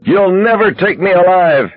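high, defiant gangster cry; gleefully delirious yell. A narrator's reading. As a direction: yell it high, wild with joy. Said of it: "he yelled, delirious with joy".

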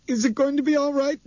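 put-on high, frightened child's voice; a scared girl begging for comfort; frantic little girl. A narrator's reading. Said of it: "Dinah asked frantically".

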